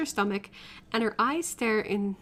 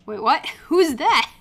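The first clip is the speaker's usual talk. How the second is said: startled, loud and laughing; surprised; 4 semitones higher, 8 dB louder, slower than usual. Acted. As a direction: jump in alarm, then laugh. Startled by someone's arrival.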